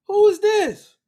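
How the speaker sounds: high loud who is this; mock astonished. Reacting to the old photo, high and loud. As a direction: gasp at the picture in fake shock.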